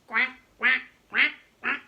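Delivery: loud quacking; duck quack. Acted. The duck quacks loudly at Spot.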